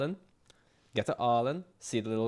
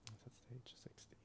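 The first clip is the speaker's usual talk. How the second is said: whispering; 24 dB quieter than usual. Real, not acted. Muttered aside while working.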